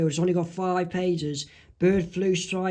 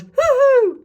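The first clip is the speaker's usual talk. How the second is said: high, loud, long whoop; happy cheer; 8 dB louder than usual. Acted. Homer celebrates the doctor's good news, high loud whoop. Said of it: "is happy and pleased and goes".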